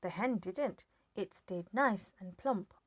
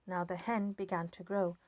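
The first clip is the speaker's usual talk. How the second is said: usual speaking voice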